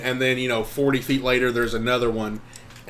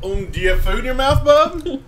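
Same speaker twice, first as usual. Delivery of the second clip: laughing; 12 semitones higher, 5 dB louder than usual. Real, not acted. Teasing echo of partner.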